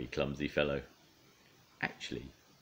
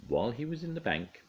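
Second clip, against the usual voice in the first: ordinary delivery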